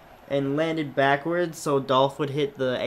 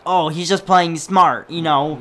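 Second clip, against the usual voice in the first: mock approval; 3 semitones higher, 9 dB louder than usual. Commentator excusing a heel's dirty win, loud mock approval. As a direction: imitate a commentator loudly defending the cheat. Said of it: "then a heel does it, and he's like".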